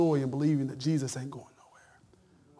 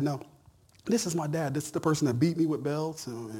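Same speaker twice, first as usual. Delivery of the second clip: ordinary talk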